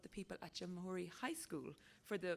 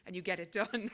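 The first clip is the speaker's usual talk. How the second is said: laughing; 6 dB louder than usual. Real, not acted. Laughs at own joke.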